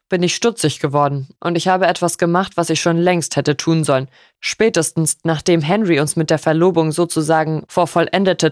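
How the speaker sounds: formal, controlled accusation in a father's voice; grave father. Acted.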